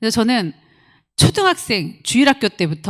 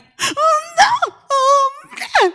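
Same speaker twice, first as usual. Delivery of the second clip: high, drawn-out crying whine; hammed-up sulky sobbing; mock tearful wail; mock whimpering; 3 dB louder, much slower than usual. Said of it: "뭐 이랬어요?".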